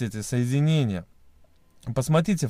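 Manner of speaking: usual speaking voice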